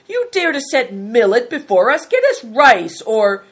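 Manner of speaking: loud, indignant scolding; angry demand. A narrator's reading. A hungry guest scolding the innkeeper, loud angry demand. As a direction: berate the innkeeper loudly. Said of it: "shouted Good Fortune".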